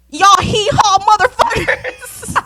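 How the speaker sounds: loud, high, slow hillbilly mockery; mock Southern accent. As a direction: holler it in a hammed-up country twang, half laughing. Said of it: "I was hearing them talking".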